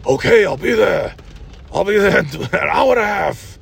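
hammed-up shouty movie-star reply; Al Pacino. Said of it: "Stallone told Al Pacino".

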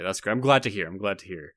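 ordinary delivery

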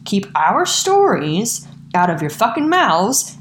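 ordinary talk, nothing marked

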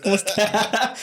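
high, mock-sweet hello; fake polite greeting. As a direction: greet him like a stranger. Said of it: "Pinche viejo hipócrita".

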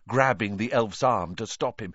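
usual speaking voice

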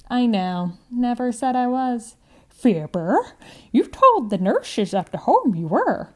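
lightly voiced old grandmother, teasing; a sly old woman calling out a fib; hint of an elderly lady, playful.

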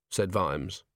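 ordinary delivery

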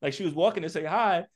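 ordinary talk, nothing marked

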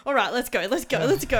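loud, repeated chivvying; rally them briskly, imitating Dad; loud mock-impatient dad; dad hurrying everyone. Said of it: "then Dad's like".

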